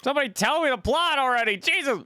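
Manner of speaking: loud, high, impatient yell; exasperated shout. Real, not acted. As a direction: yell at them to hurry up. Impatient outburst at slow story.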